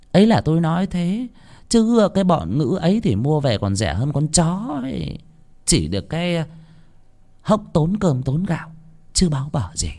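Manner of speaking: high, sneering village matriarch; contemptuous landlord's wife. A narrator's reading. Wife sneering to her husband about the laborers, high and scornful. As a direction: spit scorn at the poor.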